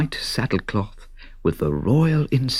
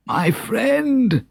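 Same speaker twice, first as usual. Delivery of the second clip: much higher, pleading king's voice; king crying out. A narrator's reading. Wounded king begs the donkey for help, high and pleading. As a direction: cry out to the stranger for help. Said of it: "he cried out".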